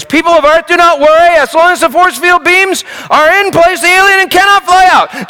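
high, loud hammed-up reassurance; mock announcer yell. Technician calls to the audience in a hammed-up yell. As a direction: bellow it like a staged ride host. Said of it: "The technician yells".

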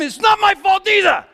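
loud, high indignant yell; yelling back. Retold or imitated. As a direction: shout it right back at him. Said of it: "And I yelled back at him".

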